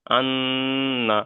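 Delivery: "আন্না" with a long-held nasal n; ghunna demo. Shown as a demonstration. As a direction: draw out the doubled n in "আন্না" very slowly. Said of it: "তাহলে এখানে কেমন করে পড়তে হবে".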